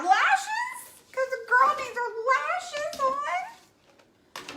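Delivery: high, slow, delighted gush. Real, not acted. Painter adding lashes to her painted girl, high delighted gush. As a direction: stretch it out, thrilled with the lashes.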